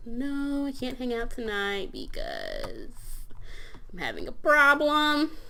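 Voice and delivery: high, slow pretend whine; put-on high, lingering refusal; drawn-out excuse. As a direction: stretch out the words high, swelling on the last. Voicing a figure declining plans.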